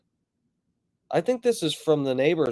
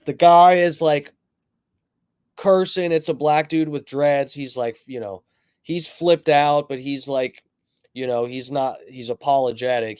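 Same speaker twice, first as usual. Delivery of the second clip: ordinary talk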